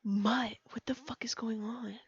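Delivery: unvoiced whisper of the line; whispered retry. Real, not acted. Player retrying her line in a whisper. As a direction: whisper it to stop the giggles. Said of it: "I can't say Mutt's name".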